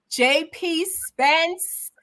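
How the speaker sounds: hype announcer. Real, not acted. Hyping up the guest's arrival.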